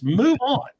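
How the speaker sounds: high, fed-up retold dismissal; exasperated retort. Repeating the reply to a complainer, exasperated.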